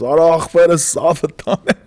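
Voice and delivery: Armenian guy. Retold or imitated. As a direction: mimic a stranger speaking Armenian. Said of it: "This Armenian guy goes".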